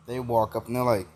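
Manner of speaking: ordinary delivery, nothing marked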